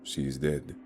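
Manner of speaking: a hint of a firm, calm guide; steady him, keep it level. Guide Leif telling the group Katie is dead, lightly voiced calm. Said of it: "Leif was somehow remaining calm".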